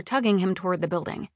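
ordinary delivery

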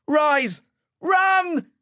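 very high, desperate shout; top-of-voice yell. A narrator's reading. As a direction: yell with everything to warn a friend. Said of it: "yelled at the top of his voice".

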